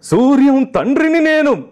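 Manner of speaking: high, loud, drawn-out declamation; Gemini Ganesan impression. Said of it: "పెంచుతారు, pitch పెంచుతారు".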